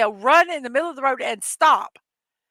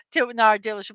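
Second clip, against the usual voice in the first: ordinary delivery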